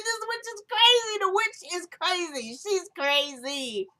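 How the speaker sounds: high-pitched, drawn-out amused disbelief; incredulous outburst. Real, not acted. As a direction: exclaim it high, stretching "crazy". Own amused outburst at the line.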